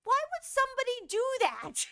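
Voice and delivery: high, baffled mock-mom voice; mimicking mom. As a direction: daughter doing her mom's puzzled question. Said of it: "And she goes".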